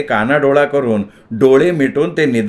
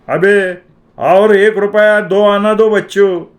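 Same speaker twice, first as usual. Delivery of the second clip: high, loud, slow snap; snapping shopkeeper; 7 semitones higher, 4 dB louder, slower than usual. A narrator's reading. Shopkeeper snapping at the boy for more money, loud and slow. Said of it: "दुकानदार खेकसला".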